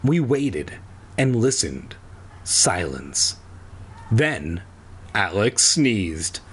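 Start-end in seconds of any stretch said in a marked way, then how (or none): none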